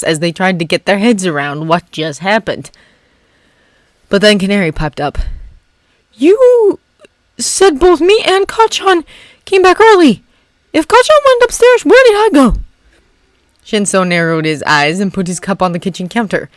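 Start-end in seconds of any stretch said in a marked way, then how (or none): 6.1-12.6 put-on higher, anxious young hero; Canary's anxious voice. Canary asking the group where he went, anxiously.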